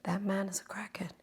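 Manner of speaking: hushed confiding; whispered secret. Real, not acted. Between viewers and her, a whispered secret.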